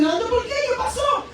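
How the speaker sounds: a coworker's startled, rising concern; concerned surprise. Retold or imitated. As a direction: ask in alarm what happened. Coworker presses anxiously.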